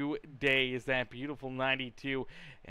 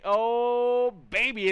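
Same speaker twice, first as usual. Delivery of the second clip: excited; 6 semitones higher, 7 dB louder, much slower than usual. Real, not acted. Pole position announced.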